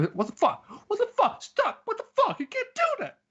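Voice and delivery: very high, loud shriek of disbelief with a laugh; shrieking incredulity. Shown as a demonstration. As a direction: scream it like you can't believe your eyes. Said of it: "shriekingly, like, uh, incredulous reaction".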